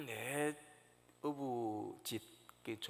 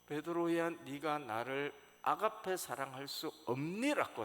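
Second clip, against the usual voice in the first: louder, higher, pressing call of the name; emphatic question; 6 semitones higher, 8 dB louder than usual. Retold or imitated. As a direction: drive the question home. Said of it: "다시 한번 강조해서 묻는".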